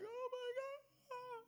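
high, thin, drawn-out fake sob; tiny high-pitched imitation of crying; mock crying. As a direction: wail it softly and slowly, hammed-up weeping. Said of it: "Joseph's crying like".

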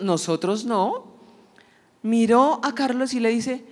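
usual speaking voice

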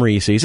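Reese's said as REE-seez; Reese's with two long ee vowels; common mispronunciation. Shown as a demonstration. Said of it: "one of those tomato-tomato things".

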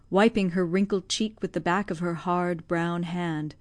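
ordinary delivery, nothing marked

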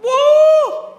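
loud high whoop of praise; shouted woo. Retold or imitated. Worshipper in a service calling out, a loud high woo. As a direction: yell out a joyful woo. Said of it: "maybe yell out".

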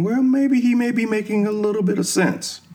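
high-pitched musing of a half-convinced listener; lifted high, like thinking aloud for someone else; imagined listener's thought. Retold or imitated. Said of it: "you're sitting there thinking".

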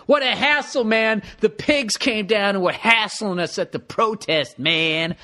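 hammed-up sixties activist, stretching words; mock hippie protester. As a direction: parody an old protester complaining. Mocks writer as protesting hippie.

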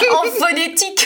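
high burst of laughter then words; laughing. Real, not acted. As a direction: laugh it out, amused at him. Mocking husband's French spelling. (éclat de rire aigu)

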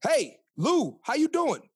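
friendly greeting demo. Shown as a demonstration. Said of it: "call 'em".

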